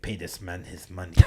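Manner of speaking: soft, hammed Russian accent; Russian movie-character impression. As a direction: quote it in a put-on Russian accent. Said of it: "right from the movie Rounders".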